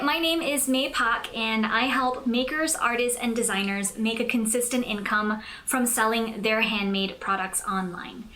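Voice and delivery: usual speaking voice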